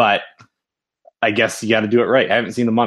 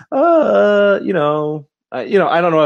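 hesitant backing-off; 8 semitones higher, 6 dB louder than usual. Retold or imitated. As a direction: stall on a long, raised "uh". Acting out the studio backing away.

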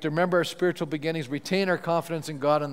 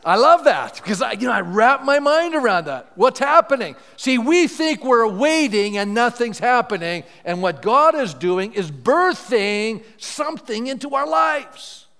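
louder, higher preacher's build over the words; fervent preaching; 7 semitones higher, 8 dB louder than usual. Acted. Pastor rousing the congregation about waiting, in a loud preaching voice. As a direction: preach it out with full fervor.

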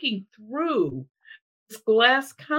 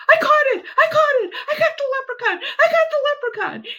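high, loud, thrilled cry of triumph; woman thrilled at her catch; excited squeal; 4 dB louder, faster than usual. Retold or imitated. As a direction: squeal it like she just caught something. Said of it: "she's so excited".

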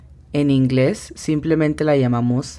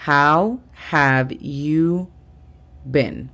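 "How have you been?" repetido aún más despacio; repítelo lento para que lo copien; slow repeat; much slower than usual. Shown as a demonstration. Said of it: "la tercera frase del día sería". (repetición muy lenta)

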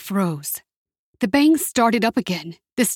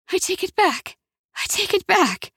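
desperate muttering; 12 semitones higher than usual. A narrator's reading. Jessica clings to Isaac as EMTs pull, desperate muttering.

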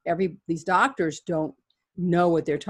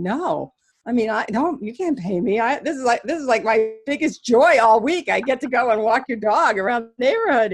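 high, loud, gushing refusal; excited child turning down money, voice up and loud; eager protest; 7 semitones higher, 7 dB louder than usual. Retold or imitated. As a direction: insist it's your biggest joy. Her eager refusal of pay, retold.

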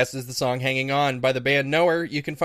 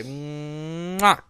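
quick kiss. Real, not acted. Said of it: "I gotta make a kiss noise".

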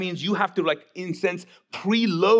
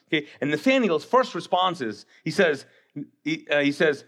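ordinary talk

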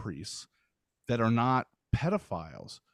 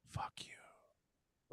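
whispered segment title; 13 dB quieter than usual. Acted. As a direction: whisper the title, voiceless and hushed. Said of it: "everyone's most anticipated".

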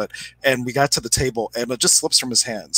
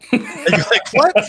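laughing high; 11 semitones higher, 4 dB louder than usual. Real, not acted. Student baffled, teller cracks up.

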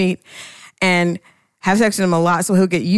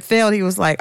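ordinary talk, nothing marked

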